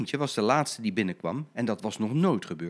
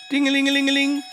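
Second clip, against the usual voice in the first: high, loud sung ding-a-ling; tram bell ringing; 7 dB louder, faster than usual. Acted. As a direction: ring it out like a bell. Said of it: "klingelde Blauwe Katrientje".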